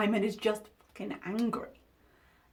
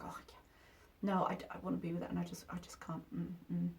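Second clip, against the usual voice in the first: softer, quick mumble, mocking her as if muttering to herself; mock mumbling quote; 6 semitones lower, 13 dB quieter, much faster than usual. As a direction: mutter it fast under your breath, mocking. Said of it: "and she was like".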